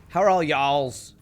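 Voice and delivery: put-on slow, drawn-out Texan accent; full-on Texan. Streamer greeting chat after going Texan, drawn-out Texan accent. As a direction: stretch it out in broad Texan. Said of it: "turn full on Texan here".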